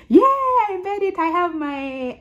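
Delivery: high, drawn-out celebrating yeah; excited cheer. Retold or imitated. Retelling her exam success.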